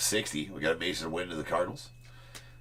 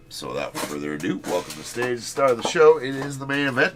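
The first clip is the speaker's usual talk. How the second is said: announcer hype; 7 semitones higher, 7 dB louder than usual. Acted. Hyping the main event reveal.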